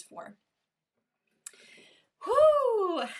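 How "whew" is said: high, loud exhaled whoop; loud whew. Real, not acted. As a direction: let out a big releasing whew. Resets after finishing the story.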